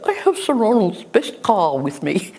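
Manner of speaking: high-pitched imitation of an English lady; very English accent. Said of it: "in this very English accent".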